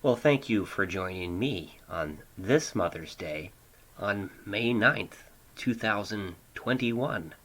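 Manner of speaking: normal talking voice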